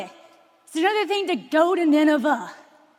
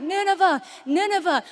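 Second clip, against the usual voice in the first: God crying out; 5 semitones higher than usual. Retold or imitated. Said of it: "He's crying out".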